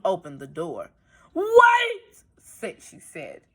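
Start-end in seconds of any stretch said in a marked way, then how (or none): 1.3-2.2 startled grandmother. Acted. Grandmother alarmed.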